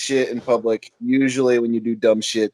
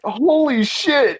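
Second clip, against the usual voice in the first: slow, high, amazed holy shit; stunned; 3 dB louder, much slower than usual. Retold or imitated. Telling friends about the theft, slow high amazement. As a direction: drag it out in disbelief. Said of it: "I was like".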